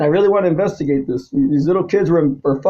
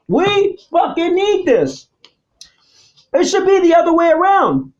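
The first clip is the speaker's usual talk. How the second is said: raised, high, shouting anger; furious rant; 8 semitones higher than usual. Real, not acted. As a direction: yell your outrage at them.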